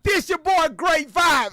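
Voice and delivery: loud, high hype-man yell; hype intro shout. Acted. Streamer hyping his own entrance over sound effects, shouting. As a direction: announce yourself like a ring entrance. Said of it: "There go my sound effects".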